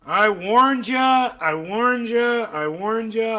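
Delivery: drawn-out sing-song told-you-so, fading; taunting I warned ya. Real, not acted. Streamer taunting his audience, slow sing-song.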